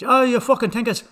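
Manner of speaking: high, loud mock outburst; mock scolding shout. Irish person calling out someone acting wild, mock scolding. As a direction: shout it as an exaggerated insult. Imitates people using a slur.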